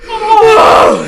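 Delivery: very high shocked shout. Retold or imitated. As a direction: yell in disbelief. Shocked reaction retold.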